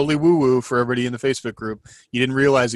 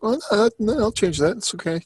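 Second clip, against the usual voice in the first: meek backing down; 10 semitones higher than usual. Retold or imitated. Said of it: "where I'm just like".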